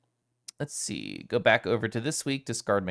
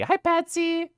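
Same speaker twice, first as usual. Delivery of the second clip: high-pitched greeting; slower than usual. Real, not acted. Greeting a chat viewer.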